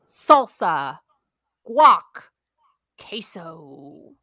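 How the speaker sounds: host voice with a whispered last item; host introducing the dips to Sarah, showy; game show host. Acted. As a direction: present the dips like a quiz master. Host presents the round.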